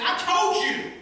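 high, loud told-you-so jab. Acted. Jonah scolding God, a loud high told-you-so. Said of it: "Basically, Jonah's saying".

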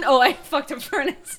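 ordinary delivery

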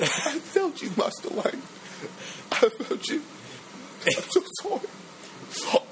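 high, breaking British voice, mock weeping; mock tearful butler. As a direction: ham up a sobbing apology, voice thinning out. Acting Alfred's tearful confession to Wayne.

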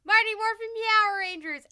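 loud, high hype announcement; announcer voice. Retold or imitated. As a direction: boom it out like a trailer. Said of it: "made the big announcement and was like".

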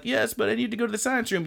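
high, quick mock teacher; a hint of a puzzled teacher. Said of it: "And he's like".

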